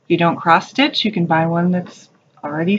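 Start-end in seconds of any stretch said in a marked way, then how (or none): none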